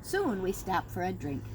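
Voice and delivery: ordinary delivery, nothing marked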